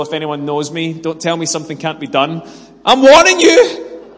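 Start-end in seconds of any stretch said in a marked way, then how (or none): 2.8-4.1 high, loud, drawn-out mock threat; hammed-up angry yell; imitated shouted warning. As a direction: shout a warning like an irate neighbour. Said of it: "slightly elderly gentleman shouting at me".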